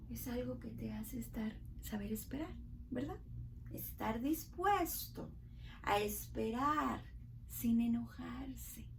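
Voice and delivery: teacher gently explaining patience; calm and gentle. Real, not acted. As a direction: ease them into patience. Soft calm definition of patience.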